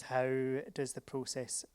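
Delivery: ordinary talk, nothing marked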